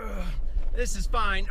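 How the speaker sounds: normal talking voice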